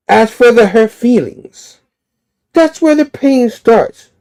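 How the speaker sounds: raging villain, pitched high and loud; Kingpin. Acted. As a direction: push it up into a hammed-up, menacing rage. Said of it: "drawing of Kingpin just in rage".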